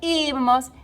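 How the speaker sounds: ibamos with a high, stretched opening I; stress on first I. Shown as a demonstration. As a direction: lean long on the first syllable of ibamos. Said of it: "no accents on that verb except for".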